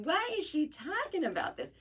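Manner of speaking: high-pitched mimicked mother objecting; puzzled mom impression. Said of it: "she'll be hearing this going".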